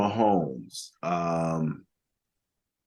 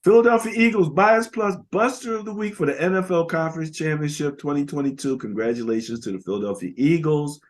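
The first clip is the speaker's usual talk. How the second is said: award announcer; 6 semitones higher than usual. Acted. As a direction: announce the winner like a ceremony host, starting big then easing down. Announces the weekly award winner.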